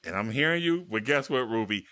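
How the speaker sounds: ordinary talk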